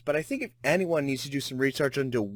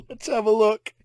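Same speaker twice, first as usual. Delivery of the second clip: laughing; 8 semitones higher, 4 dB louder than usual. Real, not acted. Still laughing.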